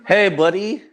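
cheerful hi to his son. Real, not acted. Greeting a small child warmly.

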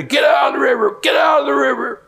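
retold furious shout of a barge worker; screaming. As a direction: yell him off the river. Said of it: "starts screaming at me".

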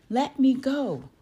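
high, loud protest; demanding. A narrator's reading. Girl struggling to break free from bullies, demanding. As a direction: cry out to be released. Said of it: "Coco demanded as she".

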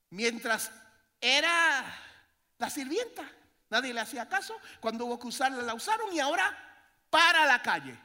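loud, high impassioned sermon; preaching at full force. Real, not acted. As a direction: hammer the story of Hagar.

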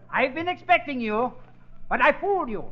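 ordinary talk

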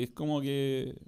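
ordinary talk, nothing marked

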